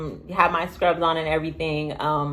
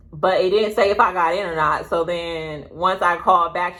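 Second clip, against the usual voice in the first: normal talking voice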